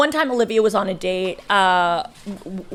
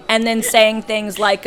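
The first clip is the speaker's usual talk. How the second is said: usual speaking voice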